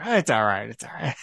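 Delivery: low, soft murmur, fading away; soothing reassurance. Retold or imitated. Colonel reassuring the nurse when she's being nasty, soothing. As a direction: gently soothe her, voice dropping. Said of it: "just kind of reassures her".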